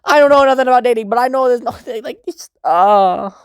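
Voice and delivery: high, rushed, spluttering protest; flustered exasperation. Real, not acted. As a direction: splutter upward until words run out. Flustered rant about dating.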